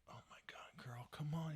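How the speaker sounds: near-whispered urging; will her on under your breath; hushed parental frustration. Retold or imitated. Parent watching daughter's soccer, hushed exasperation.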